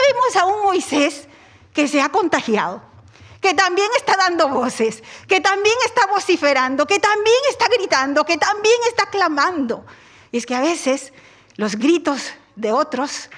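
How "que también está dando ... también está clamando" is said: rising preaching. Real, not acted. Preacher building about shouting.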